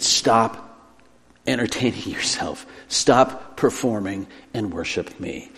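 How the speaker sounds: low, soft, spaced-out command; quietly plead, phrase by phrase; slow, grave quiet urging; hushed appeal. Acted. God's appeal, voiced by preacher.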